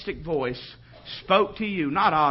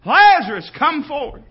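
loud, high summons; booming preacher calling out the dead; majestic, powerful command; 7 semitones higher, 9 dB louder, slower than usual. Acted. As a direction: holler it with full authority. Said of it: "by the majesty and the power of his voice".

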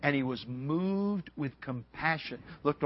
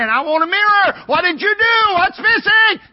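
high, shouted mock panic; hammed-up frantic demand at full volume; emotional outburst; 16 dB louder than usual. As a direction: yell it like an upset salon customer. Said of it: "you'd get emotional about that".

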